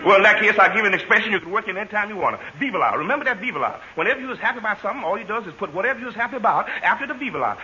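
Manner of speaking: ordinary delivery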